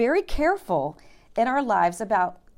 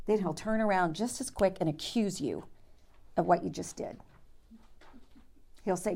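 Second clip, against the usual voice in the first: ordinary talk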